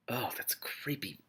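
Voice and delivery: slow hushed whisper; creeped out. Real, not acted. Reader spooked by the book, whispering. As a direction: breathe it out, spooked.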